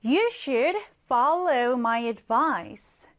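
very slow, clear English sentence; slow teacher's reading; slow example read. Shown as a demonstration. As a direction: read it word by word for learners. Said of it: "하나 더 볼게요".

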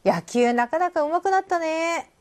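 high, drawn-out mock admiration; hammed-up praise, stretched and high; admiring compliment. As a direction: gush slowly at a friend's skill. Acting out a friend's admiring compliment.

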